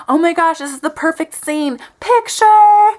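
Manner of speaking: mock-gushing, rising to a high, loud finish; gush, then squeal the last word; imitating overeager date. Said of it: "He popped right behind me and said".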